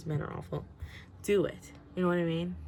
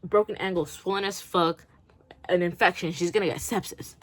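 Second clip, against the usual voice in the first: normal talking voice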